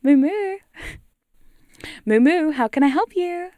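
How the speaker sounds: high, loud cow answering the phone; cow hotline voice. Acted. Cow character answering the ASMR hotline, high and loud. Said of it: "Hugh Cow department".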